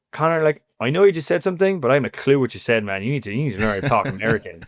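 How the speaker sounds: a hint of a drawling American friend; lightly mock the teammates' American twang; American accent. Mimicking American teammates teasing him, lightly American accent. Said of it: "because I'd be speaking with an accent".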